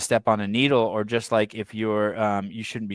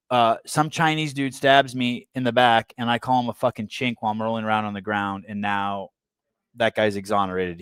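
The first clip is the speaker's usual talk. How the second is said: ordinary talk, nothing marked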